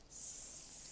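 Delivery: breathy whispered super. Shown as a demonstration. Teacher doing the snake cheer, whispered. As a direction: hiss super softly like a snake. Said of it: "and you go".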